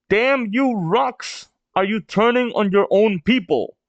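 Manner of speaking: high-pitched indignant outcry; outraged. Acted. Big Mom accusing Rocks of turning on his people, high outrage.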